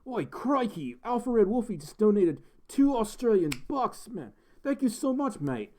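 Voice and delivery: high-pitched, hammed-up Australian accent; bad Aussie accent. Shown as a demonstration. Streamer thanks a donor on request, hammed-up Aussie accent. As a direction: do a cringey cartoon Aussie. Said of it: "kinda wanna hear your best, best Aussie accent".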